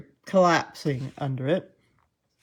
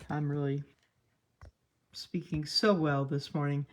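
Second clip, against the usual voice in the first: dry sarcasm at your own expense; mock your own fumbled speech, understated; sarcastic; 2 semitones lower, 6 dB quieter than usual. Real, not acted. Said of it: "that was sarcastic".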